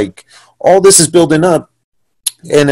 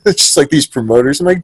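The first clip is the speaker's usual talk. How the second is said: ordinary talk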